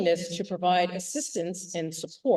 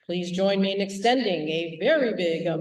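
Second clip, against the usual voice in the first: ordinary talk, nothing marked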